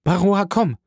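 high summoning call. A narrator's reading. Valentine summons the old servant to come, calling out. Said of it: "rief Valentin".

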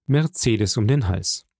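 plain reading voice

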